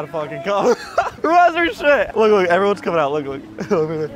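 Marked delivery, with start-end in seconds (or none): none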